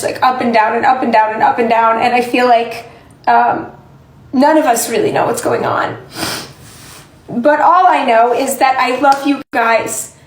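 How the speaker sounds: ordinary delivery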